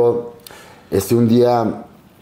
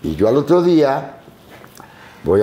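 ordinary talk

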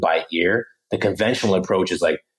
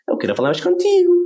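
"Eu quero falar mais contigo" quick, swooping high at the end; exaggerated Portuguese intonation on "Eu quero falar mais contigo", rising sharply; Portuguese melody; 4 dB louder, faster than usual. Shown as a demonstration. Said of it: "get that Portuguese".

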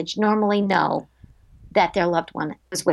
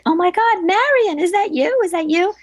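much higher, pleading cry of disbelief; emotional outcry; 9 semitones higher, 3 dB louder than usual. Retold or imitated. Retelling how she begged the bird, high and overwhelmed. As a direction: call out to the bird as if it were your dead friend. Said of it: "I'm crying".

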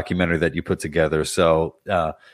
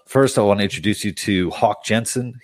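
normal talking voice

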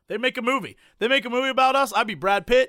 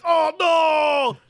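high loud dismayed cry; shocked shout; 8 semitones higher, 7 dB louder than usual. Pretend. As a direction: yell it in horror. Friend reacts to puking.

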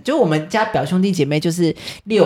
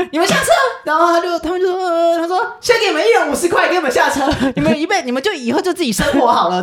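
angry aunt; 11 semitones higher than usual. Retold or imitated. Aunt dropping the kids at the park, fast raised scolding.

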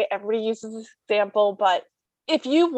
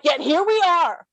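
sarcastic retort; 7 semitones higher, 3 dB louder than usual. Retold or imitated. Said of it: "And I'm like".

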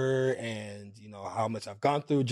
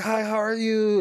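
sweet greeting; 10 semitones higher, 4 dB louder than usual. Retold or imitated. Voicing [name] greeting her.

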